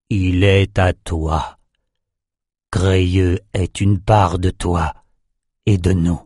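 slow, low, heavy resignation; grieving offering. A narrator's reading. King Calvaire giving his dead son to the serpent, slow grief. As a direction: surrender the body to the god slowly.

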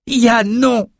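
anguished shout to a falling friend; cry out. A narrator's reading. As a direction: scream his friend's name as he falls. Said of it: "cria Bannon".

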